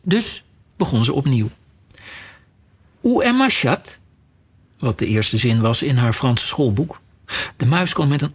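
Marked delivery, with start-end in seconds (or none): 3.0-3.9 high, careful French phrase; schoolbook French. A narrator's reading. Alice tries her first French schoolbook sentence on the mouse.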